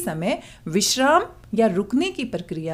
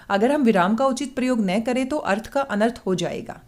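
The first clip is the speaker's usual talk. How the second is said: rushed, without pauses. Shown as a demonstration. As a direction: read it quickly with no breaks. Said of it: "मैं बहुत जल्दी-जल्दी बोल रही हूँ, बिल्कुल भी बीच में नहीं रुक रही".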